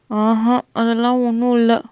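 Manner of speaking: slow, hesitant denial; a touch of nervousness, words drawn out; timid. A narrator's reading. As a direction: stall shyly while denying it. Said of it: "ஏன் என்னை பார்த்து அப்படி பயப்படுற?".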